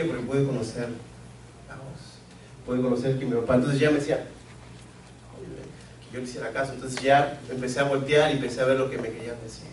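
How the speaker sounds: ordinary talk, nothing marked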